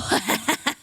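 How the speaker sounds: evil cackle; sinister laugh. Acted. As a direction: devilish villain laugh. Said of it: "that was a sinister laugh".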